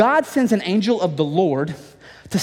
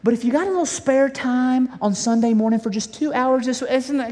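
mock polite God; 3 semitones higher than usual. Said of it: "that's not how God operates".

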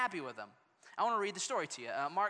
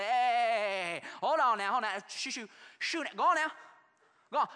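loud, higher shooing of parents; shooing disciples; 3 semitones higher, 5 dB louder than usual. Acted. Disciples shooing the parents off, loud. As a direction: wave them off like a gatekeeper.

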